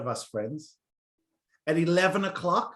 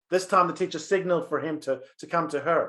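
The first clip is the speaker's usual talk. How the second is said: put-on lively storyteller reading a children's tale; story narration; 5 dB louder than usual. A narrator's reading. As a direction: tell it like a bedtime story for kids. Rabbi reads a story aloud.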